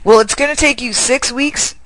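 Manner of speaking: ordinary delivery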